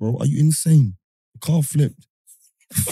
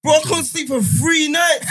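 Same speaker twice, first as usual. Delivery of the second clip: outraged raised voice about years of silence; indignant outburst; 10 semitones higher than usual. Real, not acted. Indignant rant, loud high.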